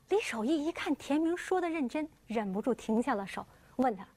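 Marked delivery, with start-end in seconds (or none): none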